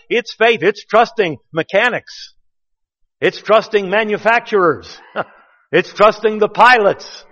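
rising preacher intensity; emphatic preaching. Real, not acted. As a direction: drive each "trusting" home like a pulpit climax. Preacher building to emphatic point.

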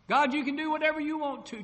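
loud, high call to God; re-enacted prayer, cried out high; surrendering cry. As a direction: give it all up at full voice.

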